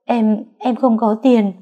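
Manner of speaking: slow stammering sob, pitch up; sobbing. A narrator's reading. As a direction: choke the words out through crying. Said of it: "vừa nói tôi vừa khóc to hơn".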